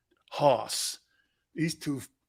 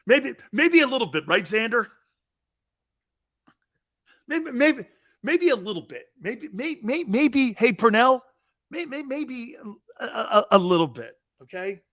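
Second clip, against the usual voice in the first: mock hesitant stammer; 7 semitones higher than usual. Mocking himself as sensitive.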